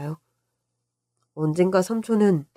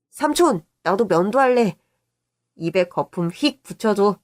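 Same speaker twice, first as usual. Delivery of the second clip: put-on eager child. Boy asking uncle to let him shave, eager. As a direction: pipe up like an eager kid.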